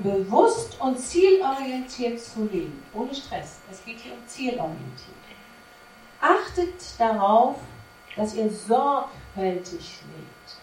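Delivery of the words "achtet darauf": ordinary talk, nothing marked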